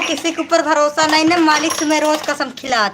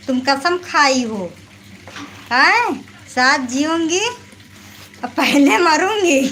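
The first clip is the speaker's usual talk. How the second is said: mimicking husband; slower than usual. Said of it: "तो बोलते हैं".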